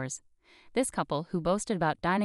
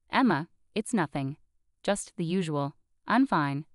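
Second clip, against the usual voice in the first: ordinary delivery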